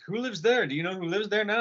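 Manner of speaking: high-pitched questioning voice; imitated curious stranger. Stranger pointing at his house, high inquisitive voice.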